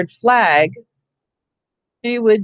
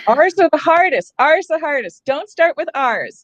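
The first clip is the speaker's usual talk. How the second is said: high joking exclamation; 8 semitones higher than usual. Real, not acted. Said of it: "joking complaint".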